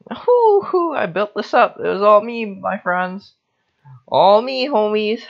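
high, loud, proud crowing; tipsy pride in a finished build; triumphant gloating. Real, not acted. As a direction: crow about what you built.

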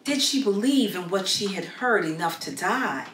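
ordinary delivery

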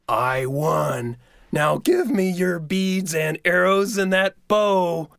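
sly pushy trickster; Coyote voice. Acted. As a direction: coax like a wheedling coyote. Coyote claims prize.

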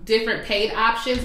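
usual speaking voice

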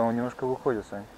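normal talking voice

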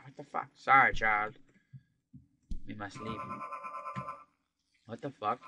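usual speaking voice

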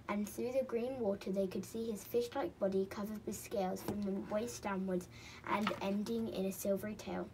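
plain reading voice

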